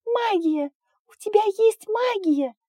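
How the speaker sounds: high delighted squeal; excited child. Acted. Delighted little dragon girl.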